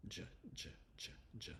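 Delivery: soft, short "J" sounds like a soft G, evenly repeated; "J" as the opening sound of jar, not the letter name; isolated J sound. Shown as a demonstration. Teacher breaking the J out of jar, isolated sound. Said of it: "it only has one sound".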